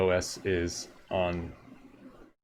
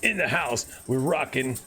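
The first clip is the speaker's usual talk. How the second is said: announcer voice; 5 semitones higher than usual. Acted. Announcer voice mic check.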